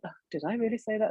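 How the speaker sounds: usual speaking voice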